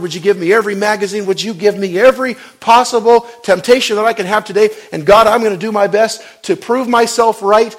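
loud, high prideful pleading prayer; mock boastful prayer. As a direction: play a self-sure believer daring God. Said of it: "I don't say".

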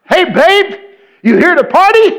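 high, loud come-on; party girl. Acted. Party girl accosting the preacher in an elevator, loud high squeal. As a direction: belt it out like a party girl hollering. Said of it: "one of 'em says to me".

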